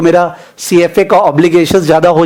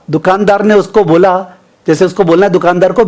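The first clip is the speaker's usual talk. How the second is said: usual speaking voice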